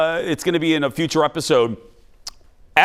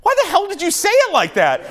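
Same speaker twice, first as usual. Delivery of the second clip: imitating baffled friends, higher; mock friends' disbelief. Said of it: "in the car going".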